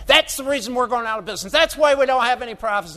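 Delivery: imitating an irate rancher, half-hearted; retold angry rant. Beef producer blaming ethanol, mock angry outburst. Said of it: "he got all violent".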